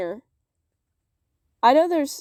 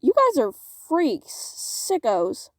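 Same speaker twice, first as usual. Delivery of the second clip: mock disgust; 10 semitones higher than usual. Real, not acted. Mock disgust at fanfic tags.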